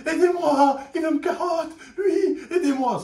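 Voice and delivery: laughing imitation of a desperate boy; mock plea for help. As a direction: beg like a bullied kid, hammed up. Boy begs schoolmates for help.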